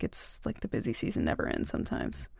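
murmured sympathy; hushed aside. Acted. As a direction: let it fade to a breath.

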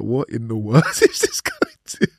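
real giggles breaking into a quoted question; can't hold back the laugh while quoting; laughing through a quote. Real, not acted. Quoting his podcast producer while laughing. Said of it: "sorry, I'm laughing because".

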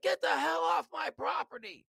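high, shouted rant at a pushy salesman; furious yelling. Retold or imitated. Angry homeowner yells at door-to-door salesman, shouting high. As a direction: yell him off your street, fed up. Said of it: "He's like".

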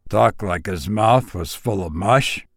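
usual speaking voice

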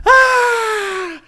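high, loud long scream; pirates' scream. Acted. Pirates hit by a shockwave of fire, screaming. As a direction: shriek as a whole crew caught by fire. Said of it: "they were all yelling and screaming".

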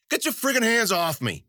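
high-pitched indignant cry. A narrator's reading. Man dragged out of line by goons, crying out. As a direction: protest while being manhandled. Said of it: "he cried".

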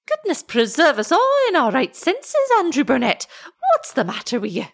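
high, alarmed Scots woman; anxious old wife. A narrator's reading. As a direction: start high in shocked dismay. Anxious wife meets husband.

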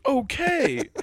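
high, falling mock okay; unmoved retail kid. Kid answering customer's plea, mock okay. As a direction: say it high, dropping at the end. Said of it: "all he said was".

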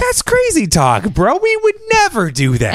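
high overacted denial; bad acting. Connie denies the plot, hammed-up high voice. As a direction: ham up the innocence. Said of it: "the acting, like, turns on".

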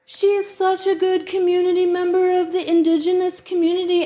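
lightly mock the people praising her; mocking the defenders. Imitating people who defended the woman, put-on gushing mockery. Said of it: "all of these people were like".

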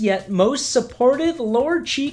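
mock commercial announcer voice; ad read. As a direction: sell it like a TV ad. Reads sponsor ad.

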